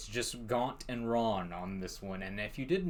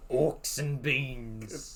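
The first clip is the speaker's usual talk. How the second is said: slow goofy drawl; 3 semitones higher, 4 dB louder, much slower than usual. Pretend.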